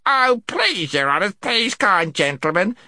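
high, pleading creature voice; squeaky, begging whimper; whimpering gnome. A narrator's reading. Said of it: "whimpered the gnome".

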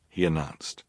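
usual speaking voice

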